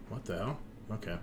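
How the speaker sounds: ordinary delivery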